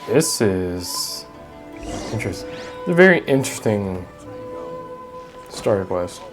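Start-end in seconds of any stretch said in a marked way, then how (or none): none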